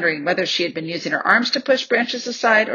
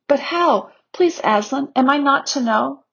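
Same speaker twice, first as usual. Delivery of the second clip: put-on higher, earnest child's plea; pleading; 7 semitones higher than usual. A narrator's reading. Lucy asking what would have happened, pleading. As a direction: beg to be told, pitched up. Lucy begs the lion to tell.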